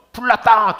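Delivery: high, loud cry of fire; alarm cry. Acted. Pastor acting out a fire alarm, shouting fire. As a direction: shout it like the bell is ringing.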